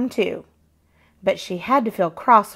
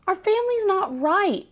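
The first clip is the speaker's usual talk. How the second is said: put-on child's complaint; 10 semitones higher than usual. A narrator's reading. Grace complains to Nana.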